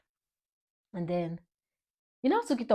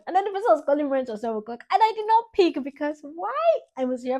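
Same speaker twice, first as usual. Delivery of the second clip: excited; 9 semitones higher, 7 dB louder than usual. Real, not acted. Excited telling about ignored calls.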